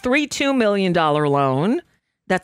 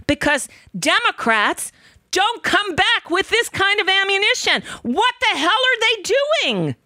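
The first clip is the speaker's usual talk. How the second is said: real anger boiling over at the Democrats; furious outburst; 10 semitones higher than usual. Real, not acted. Host venting at Democrats for not fighting back, high angry rant. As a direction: pitch shoots way up in an exasperated rant. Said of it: "Yes, I'm pissed".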